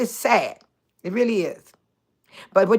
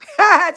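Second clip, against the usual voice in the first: high, loud real laugh; 8 semitones higher, 6 dB louder than usual. Real, not acted. Hearing about Trina's vacation, high loud laugh. Amused by caller comment.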